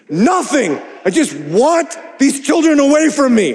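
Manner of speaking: mock-petulant dad wanting the kids gone; irritated self. Husband snapping back at his wife, petulant.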